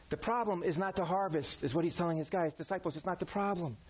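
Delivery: usual speaking voice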